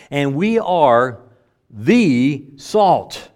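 slow, "the" set apart as a long "thee"; pause, then weight "the" as "thee" before "salt"; stress on THE. Shown as a demonstration. Preacher applies it to the congregation, stressing "the" as "thee". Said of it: "It's the definite article here".

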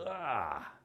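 sarcastic little ah. Retold or imitated. Someone repeating truth sarcastically, a scoffing ah. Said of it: "it's so sarcastic".

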